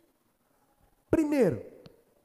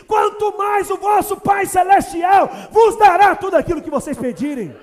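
loud, high, slow proclamation; preaching at full force; 9 semitones higher, 14 dB louder than usual. Acted. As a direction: proclaim it to the rafters. Preaching climax. (pregando com força, alto e agudo)

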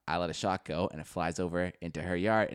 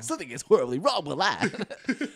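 high, laughing overacted line; hammed-up bad script; 9 semitones higher, 5 dB louder than usual. Joining the joke about mom, high hammed-up drama. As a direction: camp it up like a terrible actor. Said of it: "It's like a bad script".

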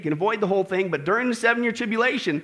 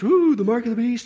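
mock fascination; 2 semitones higher, 3 dB louder than usual. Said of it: "everybody's fascinated".

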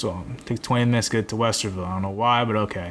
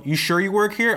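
higher-pitched imitation of a sceptical clerk; mock-doubtful question, pitched up; 8 semitones higher than usual. Clerk questions employee about working there, doubtful.

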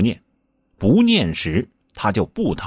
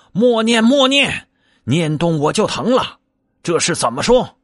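high, pleading cries of pain; crying out in pain; 8 semitones higher than usual. Acted. Wukong begs Sanzang to stop the spell, high pained cries. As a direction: beg him to stop chanting, hurting. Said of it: "只叫".

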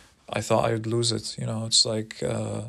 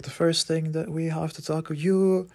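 higher, pinched mock voice of a bossy old teacher; imitating the stern teacher; 7 semitones higher than usual. Meditation teacher scolding the speaker, higher mock bossy voice. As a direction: play the scolding teacher, pitched well up. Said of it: "she looked at me and she's like".

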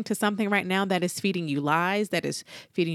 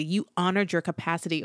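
usual speaking voice

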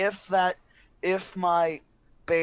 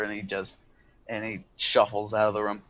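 ordinary delivery

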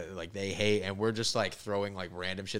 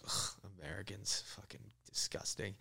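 disgusted lady; 12 dB quieter than usual. Retold or imitated. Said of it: "she goes".

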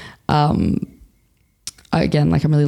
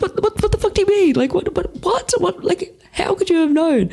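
very high, sputtering mock disbelief; flabbergasted. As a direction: stumble over the repeated questions in squeaky shock. Stunned reply to mum, retold.

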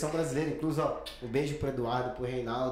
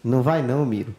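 mock warning; 10 dB louder than usual. Jokingly voicing her intuition.

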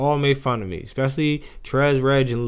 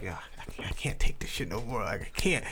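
defeated mutter; 5 semitones lower, 9 dB quieter than usual. Retold or imitated. Fed up with being teased.

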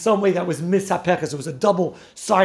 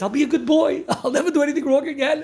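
a half-laughing, higher-pitched pleading retelling; mock crying plea; 6 semitones higher than usual. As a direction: play the villain begging like a scolded kid, tongue in cheek. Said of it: "crying to Pinchas".

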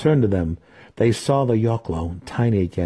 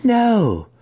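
much higher, loud outcry; cried out; 6 dB louder than usual. A narrator's reading. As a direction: cry out the refusal. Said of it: "cried Malice".

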